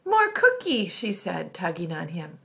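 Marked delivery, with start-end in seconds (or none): 0.1-0.9 put-on high, loud little-kid demand; pleading toddler. A narrator's reading. Toddler tugging on big brother for a cookie, high pleading. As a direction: pipe up like an insistent toddler.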